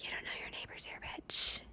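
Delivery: hushed sneering locals; mock snide whisper. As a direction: whisper it with scorn. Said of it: "everyone's staring at me like".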